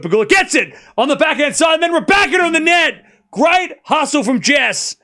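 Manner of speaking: excited call. Real, not acted. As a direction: ride the rally as it peaks. Rally climax, live call.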